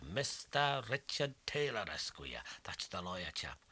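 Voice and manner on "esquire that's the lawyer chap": ordinary delivery, nothing marked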